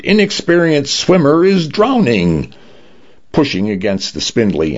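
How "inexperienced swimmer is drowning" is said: lifeguard raising a narrated alarm; shouted alarm. A narrator's reading. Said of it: "the lifeguard shouted".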